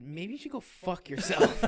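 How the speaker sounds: punchy mock-angry retort; retold defiant retort. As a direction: deliver the insult slowly and forcefully, as a retold comeback. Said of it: "looked him dead in the eye".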